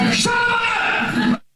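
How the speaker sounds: a shrieking shut up; shouting shut up. Retold or imitated. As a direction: yell it at the screen in disbelief. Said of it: "I'm like".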